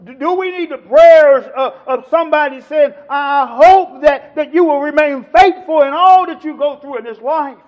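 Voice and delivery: loud, emphatic pulpit appeal; big preacher's voice, leaning on key words; full-force preaching. Real, not acted. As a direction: press the appeal hard on the congregation.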